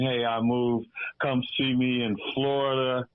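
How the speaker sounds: usual speaking voice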